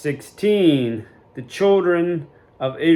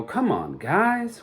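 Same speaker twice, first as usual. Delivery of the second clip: fond, head-scratching exasperation; a hammed-up sigh of disbelief at the people; exasperated plea; 8 semitones higher, slower than usual. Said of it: "scratch your head and go".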